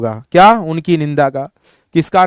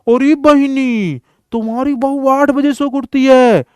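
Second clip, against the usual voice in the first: put-on old grandmother gossiping about a lazy daughter-in-law; gossiping old woman; 6 semitones higher than usual. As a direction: drag it out like a village grandma complaining. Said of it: "दो बुढ़ियों की बातचीत".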